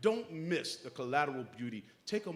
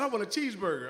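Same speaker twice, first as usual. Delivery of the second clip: put-on higher, louder eager kid's answer; higher and louder eager "I want a cheeseburger"; enthusiastic child; 7 semitones higher, 6 dB louder, faster than usual. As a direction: answer like a kid who really wants it. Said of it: "With such enthusiasm".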